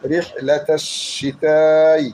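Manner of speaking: শিতাই held very slowly for four alifs; very slow recitation with a long drawn vowel; long stretch. Shown as a demonstration. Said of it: "চার আলিফ টান".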